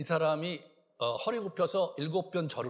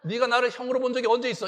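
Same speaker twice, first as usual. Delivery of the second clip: indignant retort; 6 semitones higher, 5 dB louder than usual. Retold or imitated. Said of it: "이렇게 하면".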